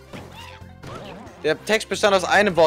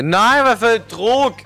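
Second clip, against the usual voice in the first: high, louder, drawn-out cry of dismay; high stretched-out panicky yell; panicked outcry; 7 semitones higher, 4 dB louder, slower than usual. Real, not acted. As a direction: cry out under sudden pressure. Panics during gameplay jump.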